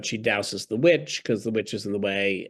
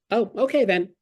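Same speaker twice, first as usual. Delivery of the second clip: higher and louder, a hammed-up naive yes; naive Dorothy agreeing; 10 semitones higher, 6 dB louder than usual. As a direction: play Dorothy giving in cheerfully without a fight. Dorothy meekly agrees to kill.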